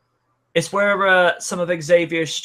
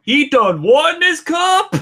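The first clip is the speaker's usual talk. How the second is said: Bear's drawl; 11 semitones higher, 7 dB louder, slower than usual. Retold or imitated. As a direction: drawl it out like a dim yokel, loud and high. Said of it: "You should hear Bear go".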